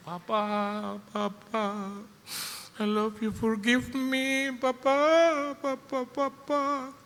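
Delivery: imitated high, drawn-out wail for Papa; child's pleading cry. Child calling to Papa from upstairs, imitated high-pitched pleading cry. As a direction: cry out high and stretched, begging forgiveness. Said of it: "dia sudah teriak".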